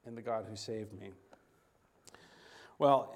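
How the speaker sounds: ordinary delivery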